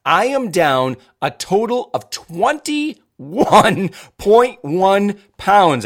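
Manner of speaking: excited. Real, not acted. Proud weight loss announcement.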